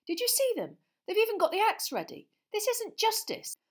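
put-on high, loud indignant boy; outraged and disgusted; angry. Boy complaining to his friends at lunch, indignant. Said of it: "said Ron angrily".

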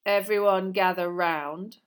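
slow, loud schoolmaster; commanding summons. Acted. Snape calls the class to watch, loud and commanding. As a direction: summon the room with slow authority.

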